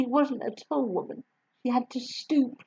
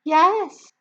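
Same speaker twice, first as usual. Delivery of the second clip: put-on higher, louder gentle young woman; kind teacher voice; 9 semitones higher, 7 dB louder than usual. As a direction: warm young teacher reassuring. Miss Honey voiced.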